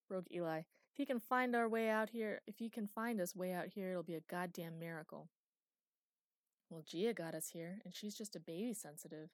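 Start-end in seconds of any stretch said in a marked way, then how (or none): 0.6-5.3 Eli scoffs loudly at Conrad finding them; scornful outburst. Acted. Eli scoffing to the crew about Conrad tracking them, loud and scornful.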